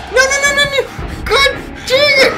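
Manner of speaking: very high, loud no no no; panicked screaming. Real, not acted. Gamer caught again, screaming in fear. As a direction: scream in terror. Monster catches player.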